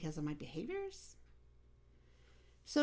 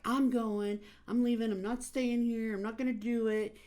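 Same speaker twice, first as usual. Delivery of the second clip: loud, higher-pitched defiant refusal; a kid shouting that he's leaving; defiant teen; 5 semitones higher, 5 dB louder than usual. Retold or imitated. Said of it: "he was like".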